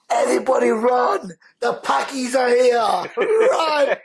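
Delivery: high, loud mock-panic shout; high-pitched alarmed holler; panicked yell. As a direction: yell the alarm as if fleeing, comically big. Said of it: "this girl goes, she looked at us and goes".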